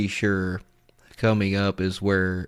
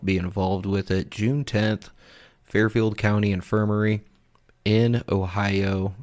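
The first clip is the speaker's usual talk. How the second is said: ordinary talk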